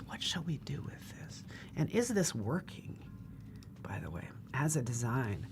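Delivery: soft unvoiced whisper to herself. Real, not acted. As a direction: whisper it under your breath. Muttering to herself while designing.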